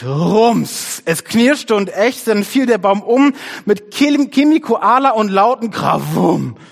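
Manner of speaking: loud, high, excited narration of a crash; picture-book climax at full volume; dramatic story reading. A narrator's reading. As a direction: boom out the sound words. Reads the tree crashing down.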